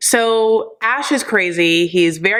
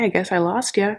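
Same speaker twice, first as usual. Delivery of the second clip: ordinary talk, nothing marked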